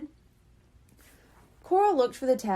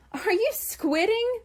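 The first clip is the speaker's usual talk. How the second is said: playful purr; 10 semitones higher than usual. A narrator's reading. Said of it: "Papa purred".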